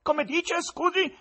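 high, loud scornful tenor. Acted. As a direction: sneer at the presenter.

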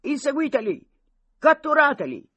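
high, loud battle order; commanding shout. A narrator's reading. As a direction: bark the chase at the troops. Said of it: "Pastor comandò". (ordine urlato, alto e forte)

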